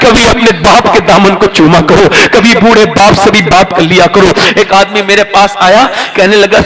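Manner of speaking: loud, sustained sermon delivery; full-force preaching. Acted. Preacher urging listeners to honour parents, loud preaching. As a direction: preach it hard to the hall.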